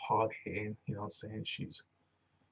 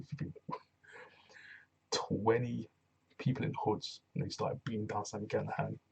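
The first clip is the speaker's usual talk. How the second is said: normal talking voice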